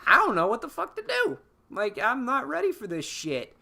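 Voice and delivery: very high, squeaky-pitched imitation of panic; mock overwhelmed. Poe beside Leia's shrouded body, mock overwhelmed. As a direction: play helpless in a high voice.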